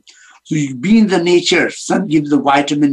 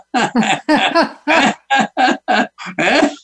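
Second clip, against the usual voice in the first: high, hearty laughing burst; demo laugh; 8 semitones higher than usual. Shown as a demonstration. Yogi shows laughing off anger, high laugh. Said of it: "Hey, hey, Marla".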